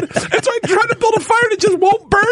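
ordinary talk, nothing marked

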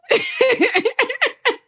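high cackling witch laugh; evil witch cackle. Acted. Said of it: "You sounded like a witch".

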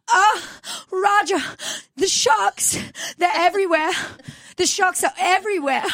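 very high, gasping mock panic; scared mid-Atlantic accent. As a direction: panic like an old-film damsel, gasping between phrases. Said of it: "they got so scared".

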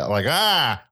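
high pinched nasal ah. Shown as a demonstration. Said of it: "Uh, high".

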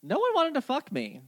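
high-pitched, put-out protest; mock-wounded protest. Real, not acted. Reacts to being left out.

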